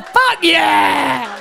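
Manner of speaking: loud hammed-up whoop of delight; triumphant mock cheer; cheering shout. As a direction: yell it from the back row like a fan. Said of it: "They're at the back going".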